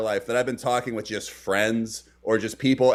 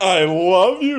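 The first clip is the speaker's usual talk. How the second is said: loud, higher, overdone declaration; mock mom; 5 semitones higher, 7 dB louder, slower than usual. Mother telling her son she loves him, hammed-up. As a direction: overplay a mother forcing it out. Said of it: "tense conversation".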